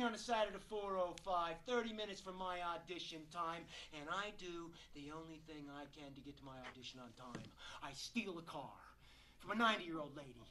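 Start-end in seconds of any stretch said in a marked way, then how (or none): 3.9-10.4 low, soft, quickened admission; sheepish confession. Acted. Actor admitting he stole an old lady's car, hushed confession. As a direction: own up to it in an undertone.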